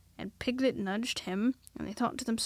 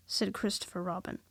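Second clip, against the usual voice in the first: ordinary delivery, nothing marked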